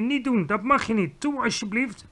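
high, anxious begging; urgent pleading. A narrator's reading. Girl begs the giant to stop, pleading urgently. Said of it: "riep Sophie".

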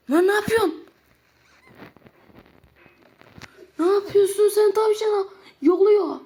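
high loud outraged cry; shocked shout. Real, not acted. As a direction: yell at what's happening to the rabbit. (şaşkın, yüksek sesli bağırış)

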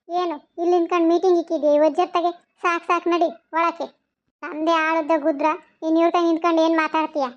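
testy, short-tempered rebuke; irritable scolding. Acted. Woman ordering her husband indoors from neighbours, irritably scolding. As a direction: break up the chat with an irritated order. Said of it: "ಯಾಕಂಗ ಎಗರಾಡ್ತೀಯೇ?".

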